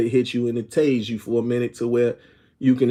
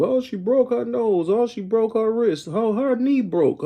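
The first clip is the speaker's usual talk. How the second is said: much higher-pitched imitation of complainers; mock complaining; 10 semitones higher than usual. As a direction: echo their fuss in a high mocking voice. Imitating complaints about daughter's fight.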